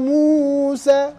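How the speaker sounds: موسى drawn out long; slow recited name. Shown as a demonstration. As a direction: stretch موسى slowly. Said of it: "هذا خطأ".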